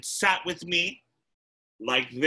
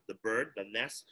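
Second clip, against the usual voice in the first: usual speaking voice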